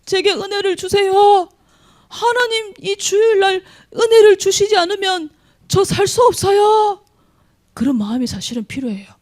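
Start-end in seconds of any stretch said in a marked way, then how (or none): 0.0-7.0 desperate shout. Retold or imitated. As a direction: cry out to God as if life depends on it. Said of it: "주님".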